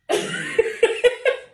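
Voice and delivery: high, bright burst of laughter. Real, not acted. Loud laugh.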